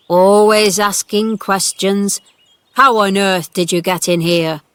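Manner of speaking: put-on loud, cross old-man scolding; cross old gardener. A narrator's reading. Gardener scolding the girl over the wall, crossly. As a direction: tell the girl off sharply from the wall. Ben scolds Mary from ladder.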